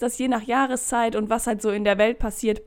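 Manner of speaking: usual speaking voice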